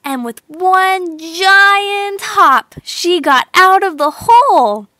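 high, loud, slow build to the top; triumphant climax. A narrator's reading. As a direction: land the big hop.

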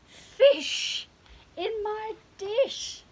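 high, loud cat voice in joyful surprise; a cat seeing its dream dinner; delighted cat. Acted. Cat finally gets fish.

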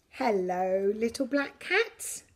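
usual speaking voice

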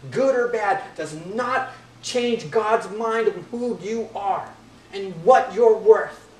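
loud, slow, building preaching. Acted. Preacher assuring the congregation of their worth, loud and building. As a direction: drive the point home.

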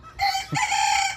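high squealing burst of laughter. Real, not acted. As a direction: squeal with delight at the goat. Laughing at goat antics.

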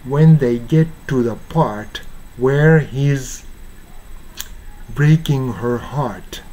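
slow careful "When they get to the part". Shown as a demonstration. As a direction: say each word apart, slowly. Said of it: "ちょっとゆっくり言いますね". (ゆっくりはっきり言う)

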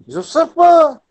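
Je sais pas in a high, loud Parisian accent, ne dropped; clipped high Parisian Je sais pas. Shown as a demonstration. Teacher demonstrates the Parisian way of saying it, high and loud. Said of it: "l'accent parisien".

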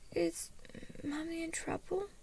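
put-on high, small child's voice; scared. A narrator's reading. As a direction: ask timidly like a frightened little boy.

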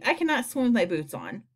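ordinary delivery

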